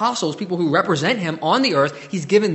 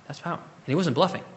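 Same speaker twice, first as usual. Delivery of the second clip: usual speaking voice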